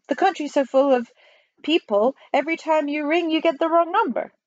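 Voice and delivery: earnest high character voice; postmaster general. Acted. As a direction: explain with pitch climbing.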